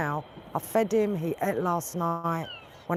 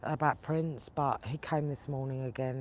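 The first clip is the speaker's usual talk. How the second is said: ordinary talk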